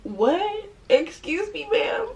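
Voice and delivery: high, incredulous questions; retold disbelief. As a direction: play up the astonishment, pitch way up. Retelling her shocked reaction.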